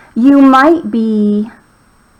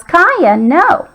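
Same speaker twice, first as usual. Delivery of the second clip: mild yell; 7 semitones higher than usual. A narrator's reading. Owner to dog jumping at a frog, mild yell. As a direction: call the dog off, lightly. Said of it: "Miss Z yells".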